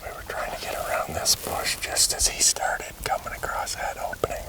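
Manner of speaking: hushed whisper so the deer won't hear; breathy unvoiced whisper while stalking; wildlife watching whisper. Real, not acted.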